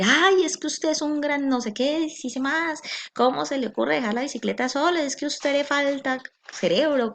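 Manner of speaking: mock him as if he were stupid; humiliating insult. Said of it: "[name] puede decir". ([name] humilla a Jaime con voz aguda y burlona)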